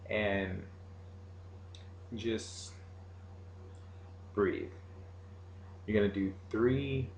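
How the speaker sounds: soft, slow meditation guide voice; right in your ear. Acted. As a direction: guide them gently into relaxing.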